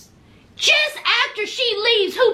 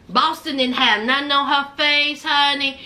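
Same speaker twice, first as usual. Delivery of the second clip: usual speaking voice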